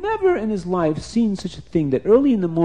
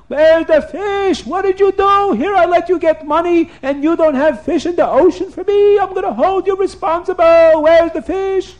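high-pitched, loud royal tirade; hammed-up furious yelling; screaming king; 13 semitones higher, 7 dB louder than usual. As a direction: scream accusations at a trembling servant. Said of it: "the king starts screaming at him".

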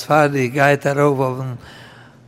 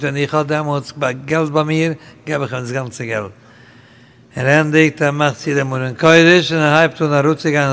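ordinary talk

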